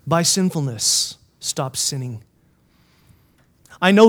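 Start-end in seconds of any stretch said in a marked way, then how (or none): 1.4-2.2 low, soft command; quiet admonition. Real, not acted. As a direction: urge them quietly and soberly. Stern quiet admonition to congregation.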